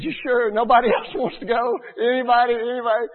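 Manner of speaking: loud, high, half-laughing plea; pleading call. Retold or imitated. Youth minister hoping someone besides the annoying kid joins, loud high plea. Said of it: "he said".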